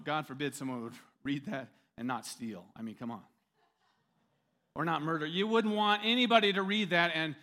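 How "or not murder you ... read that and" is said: sarcastic. Real, not acted. Sarcastic rant about covered commandments.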